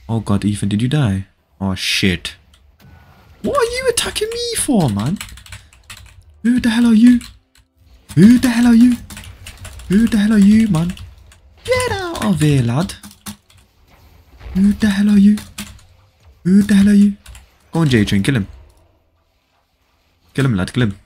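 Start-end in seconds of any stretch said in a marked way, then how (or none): 3.4-4.9 indignant surprise. Real, not acted. Suddenly attacked in game. 6.4-17.2 loud, higher, indignant yelling; yelling at a random attacker in the game; angry shout. Real, not acted. Stranger attacking him in game.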